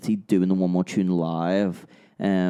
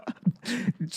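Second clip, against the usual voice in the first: high laugh; 13 semitones higher, 6 dB quieter than usual. Real, not acted. Reacting to robot joke.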